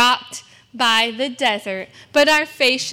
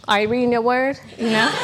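normal talking voice

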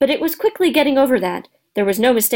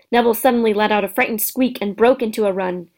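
ordinary delivery, nothing marked

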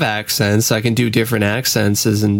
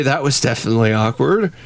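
ordinary talk, nothing marked

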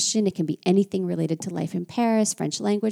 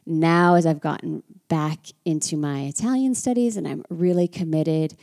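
ordinary talk, nothing marked

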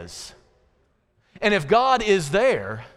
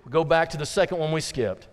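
ordinary talk

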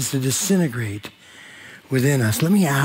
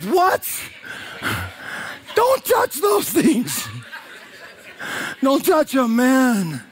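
high, laughing, mock-panicked yell; freaked-out congregant; 7 semitones higher, slower than usual. Imagining Jesus kneeling at his feet, high laughing mock panic. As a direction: play the guy yanking his feet away. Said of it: "I'm like".

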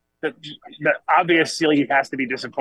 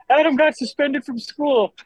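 high, slow grandmother tattling; grandmother's voice; 12 semitones higher, slower than usual. Retold or imitated. Grandmother tells grandfather he was suspended, high and slow. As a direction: squeak it out like a grandma telling on him. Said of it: "my grandmother goes".

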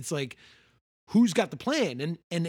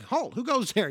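higher barked halt, who goes there; sharp sentry halt challenge; 8 semitones higher than usual. Retold or imitated. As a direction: call out like a sentry on guard, higher. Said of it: "You know, like".

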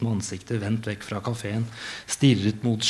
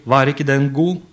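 higher, louder teasing punchline voice; sudden outburst; 4 semitones higher, 6 dB louder than usual. Retold or imitated. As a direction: burst out as if joking all along. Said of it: "skulle bryte ut og si".

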